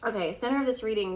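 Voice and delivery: normal talking voice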